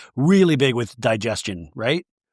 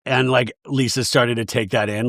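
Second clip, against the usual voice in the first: ordinary talk, nothing marked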